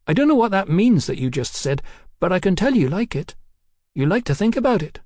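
high-voiced cheeky London girl. A narrator's reading. Hetty reads Mallory.

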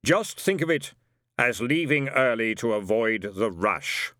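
slow, hollow Death voice; deadpan it slowly, as Death; Death's voice. A narrator's reading. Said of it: "said Death".

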